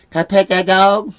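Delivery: showing his granddaughter how to say it; kid meme voice demo. Shown as a demonstration. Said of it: "Say it like this." I go".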